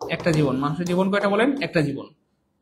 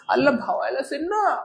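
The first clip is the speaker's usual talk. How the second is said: higher, puzzled student voice; confused student; 8 semitones higher than usual. Retold or imitated. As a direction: mimic a confused student objecting. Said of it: "বলবে". (উঁচু গলায় বিভ্রান্ত ছাত্রের নকল)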